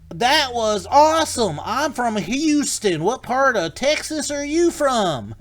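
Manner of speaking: loud, high, drawn-out Texas drawl; excited Texan. Retold or imitated. Thrilled Houston rider greeting his guide as a fellow Texan, Texas accent. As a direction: whoop it slowly in a big Texas accent. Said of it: "that's my bad Texas accent".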